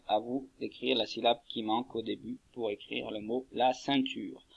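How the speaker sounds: usual speaking voice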